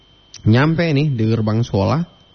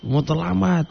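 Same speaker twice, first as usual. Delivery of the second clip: mock teacher's yell; 7 semitones higher, 3 dB quieter than usual. Teacher at the gate to a late pupil, mild mock yell. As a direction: call out like a teacher hurrying latecomers, held back. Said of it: "apa, teriak tuh".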